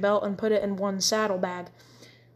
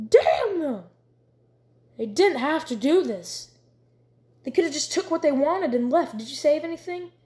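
rising anger; 6 semitones higher, 3 dB louder than usual. A narrator's reading. Tobias raging at deserters.

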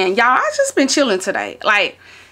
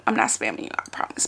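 unvoiced whisper, much softer; whispered aside; 13 semitones lower, 8 dB quieter, faster than usual. Real, not acted. To viewers, promising texts aren't spam, a soft whisper. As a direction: lean in and whisper the reassurance.

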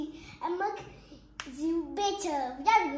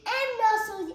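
ordinary delivery, nothing marked

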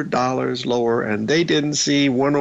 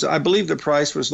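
usual speaking voice

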